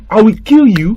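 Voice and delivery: loud threat. Acted. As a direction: punch the threat out at the child. Uncle repeats threat.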